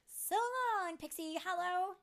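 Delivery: high, loud farewell call. A narrator's reading. Tink calls down from her rising balloon, calling out. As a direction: call goodbye across a distance. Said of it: "she called".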